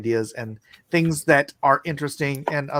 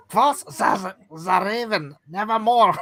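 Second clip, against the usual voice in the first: higher-pitched fake German accent; hammed-up German accent with hard consonants; mock German accent; 5 semitones higher, 4 dB louder, slower than usual. Shown as a demonstration. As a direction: recite it as a caricature German.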